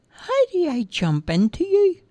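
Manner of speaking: put-on high childish voice, hesitant; puzzled little boy; young boy. Roger questions the tiny man, high and unsure.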